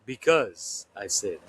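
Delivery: measured narration with swooping pitch and punched key words; dramatic story reading. Acted. Author reads a snippet from book three, dramatic narration. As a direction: read it out as a tense fantasy scene, with deliberate pauses.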